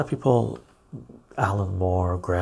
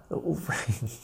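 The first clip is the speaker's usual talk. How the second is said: whispered aside; 3 semitones higher, 5 dB quieter than usual. Real, not acted. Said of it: "unvoiced whisper aside".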